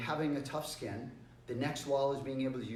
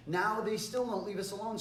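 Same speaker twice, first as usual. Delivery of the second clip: normal talking voice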